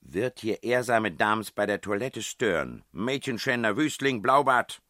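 high, loud mock-outraged scolding; indignant princess. A narrator's reading. Princess scolding a man who disturbs ladies at their toilette, loud mock outrage. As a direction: hurl the insults at full voice, high and theatrical. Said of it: "sagte die Stimme der Prinzessin".

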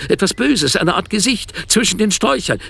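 high, scared voice of the wife; frightened wife. Retold or imitated. Wife tells husband of a face outside, high and frightened. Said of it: "sagst du".

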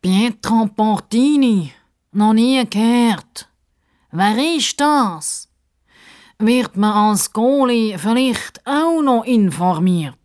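high, slow, drawn-out protest; complaining. A narrator's reading. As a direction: stretch out each indignant question. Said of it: "reklamiert der Justus".

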